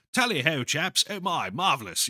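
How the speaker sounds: put-on hearty old-time RAF officer's British accent. Shown as a demonstration. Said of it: "British R A F accent".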